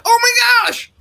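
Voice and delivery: put-on high, loud frightened kid voice; little kid shock. Six-year-old son hearing his father's threat, frightened shriek.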